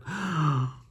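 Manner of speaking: exaggerated shocked gasp; mock gasp. Reader pretending shock at the book, mock gasp. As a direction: gasp as if scandalized.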